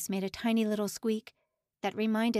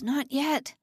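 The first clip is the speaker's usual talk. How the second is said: higher, louder begging; rising desperate plea; 6 semitones higher, 4 dB louder than usual. A narrator's reading. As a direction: plead harder, voice climbing. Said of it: "Simon groaned inwardly".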